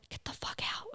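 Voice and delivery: whispered panic to herself; a scared whisper inside her head; inner-voice whisper. Retold or imitated. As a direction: hiss it as a frantic thought. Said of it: "in my mind, I was like".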